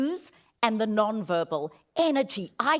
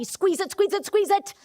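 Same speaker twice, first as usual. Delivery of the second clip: higher, urgent coaching; higher coaching call; urging squeeze; 10 semitones higher, 3 dB louder than usual. Shown as a demonstration. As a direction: push them to squeeze harder. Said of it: "contract your whole body".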